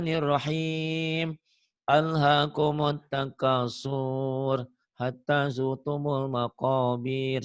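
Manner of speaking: every vowel stretched long, slow Quran chant; stretched-out Quran recitation, imitated; imitating a student's drawn-out recitation. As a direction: mimic the student dragging out each stop. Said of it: "Buat dia apa dibaca".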